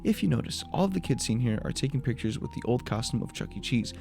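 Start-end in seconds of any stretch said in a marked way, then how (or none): none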